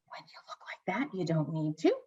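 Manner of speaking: whispered admiring aside; hushed swoon. Pretend. Podcast host explains why the actor needn't speak, hushed swoon.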